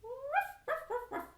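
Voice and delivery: high yapping puppy voice; squeaky high puppet dog barking. Shown as a demonstration. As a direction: yip like a little dog. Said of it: "There's our dog".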